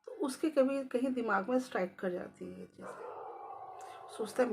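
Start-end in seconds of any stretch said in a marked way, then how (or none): none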